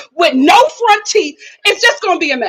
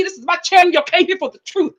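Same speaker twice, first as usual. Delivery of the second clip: ordinary talk, nothing marked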